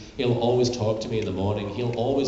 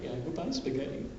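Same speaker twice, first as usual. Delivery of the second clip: tearful; 9 dB quieter than usual. Real, not acted. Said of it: "I'm sorry I started crying".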